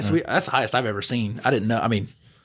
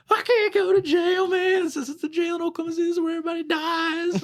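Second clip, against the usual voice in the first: mock crying. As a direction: imitate a drunk man crying and begging. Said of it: "He starts crying".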